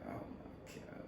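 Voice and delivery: breathless whisper of dismay. Retold or imitated. Son reacts to his mother's disappointment, a hushed whisper. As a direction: whisper it as if crushed. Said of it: "I'm just like".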